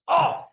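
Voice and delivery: high loud mock yelp of pain; comic pained oh. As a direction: yelp as if just punched. Buddy hit in the head.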